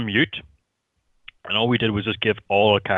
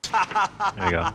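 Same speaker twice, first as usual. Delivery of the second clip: high mock echo; 10 semitones higher, much slower than usual. Clip line imitated high.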